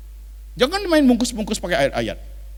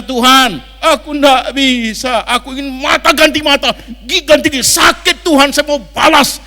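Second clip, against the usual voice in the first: honest angry prayer; 6 semitones higher, 7 dB louder than usual. Retold or imitated. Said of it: "Lebay doanya".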